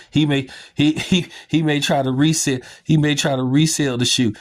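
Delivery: high, laughing, stumbling restarts; cracking up. Real, not acted. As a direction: try to get it out through real laughter. Cracks up at his own joke.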